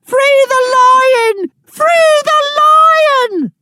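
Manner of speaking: very high slow shout; woman in crowd shouting. Acted. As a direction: shriek it from the stands. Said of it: "Woman in the crowd".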